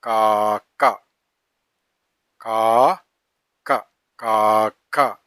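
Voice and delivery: slow reading. Shown as a demonstration. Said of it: "slow syllable demo".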